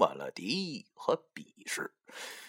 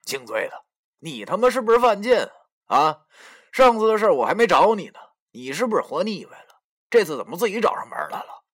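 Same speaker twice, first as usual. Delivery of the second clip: higher, louder, fast angry threat; fierce threat; 7 semitones higher, 4 dB louder, faster than usual. Acted. Youxi threatens the narrator holding a rock, fast and angry. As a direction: spit it out, ready to fight. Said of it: "指着我狠狠地说".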